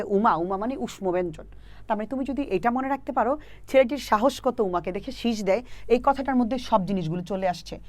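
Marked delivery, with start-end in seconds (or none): none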